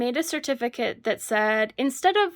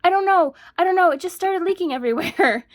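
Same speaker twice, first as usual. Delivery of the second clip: fake panic; 8 semitones higher, 6 dB louder than usual. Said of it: "I'm like".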